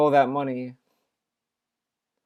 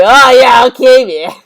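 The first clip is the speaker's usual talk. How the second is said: loud, high, put-on voice; hammed-up response to a positive tip; mock dismissive reply; 7 semitones higher, 11 dB louder than usual. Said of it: "read a positive tip and say".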